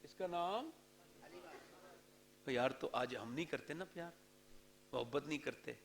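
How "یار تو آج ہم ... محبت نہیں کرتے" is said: low, soft regretful remark; soft rueful aside. Real, not acted. Preacher gently chiding listeners for not loving mothers, soft rueful tone. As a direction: sigh it out gently.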